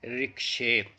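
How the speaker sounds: "रिक्शे" as ri-shay, the t silent; correct pronunciation. Shown as a demonstration. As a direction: say "रिक्शे", not "रिचोट". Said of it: "सही pronunciation". (सही उच्चारण "रिक्शे")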